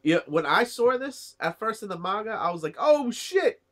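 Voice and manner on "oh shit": shocked "oh shit". Retold or imitated. Shocked reaction reading the manga.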